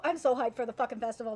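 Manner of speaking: ordinary delivery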